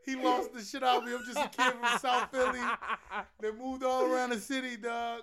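loud, high, talking through laughter; real laughing protest. Real, not acted. South Philly friend baffled by the outburst, laughing hard. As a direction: crack up while getting the words out.

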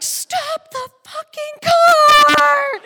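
high, drawn-out panicked scream; acted-out frantic mother; screaming mom. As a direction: shriek the order at full volume. Mom screaming as house gets close.

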